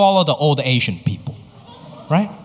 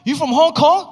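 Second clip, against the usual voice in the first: higher, excited bro-ish questioning; eager bro voice; 9 semitones higher than usual. Retold or imitated. Strangers reacting to hearing he's from Hong Kong, in an eager, higher voice. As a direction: gush like a clueless fan. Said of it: "They're like".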